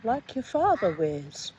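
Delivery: slow, deliberate reading aloud; story narration. Acted. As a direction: read the story slowly and evenly. Reads the short story aloud.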